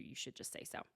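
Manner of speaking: low, unvoiced snarky aside; whispered aside. Real, not acted. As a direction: slip in a sly joke under your breath. Snarky aside about Meredith.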